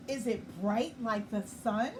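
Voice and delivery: ordinary delivery, nothing marked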